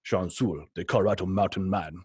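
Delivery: lightly imitated Russian-accented audiobook read; Pavel. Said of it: "there's Pavel saying my name".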